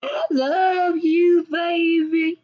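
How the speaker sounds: high, slow, syrupy sweet talk; mock lover's coo. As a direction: coo it way up high like a lovesick fool.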